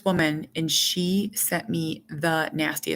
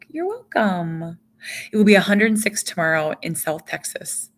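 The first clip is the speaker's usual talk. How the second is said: usual speaking voice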